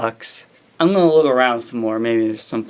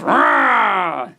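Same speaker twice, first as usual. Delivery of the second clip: sudden high monster roar; 9 semitones higher than usual. Acted. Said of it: "said the Frankenstein monster as he sat up".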